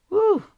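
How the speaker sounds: high, loud whoop of praise; joyful woo. Real, not acted. Preacher whooping for joy after the altar call. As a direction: let out a happy church whoop.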